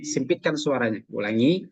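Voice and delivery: ordinary talk, nothing marked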